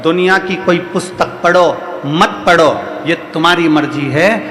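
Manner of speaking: rising, rhythmic sermon delivery; preaching. Acted. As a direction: preach it to the crowd with swelling repetition. Guru preaching to devotees. (प्रवचन की लय में उपदेश)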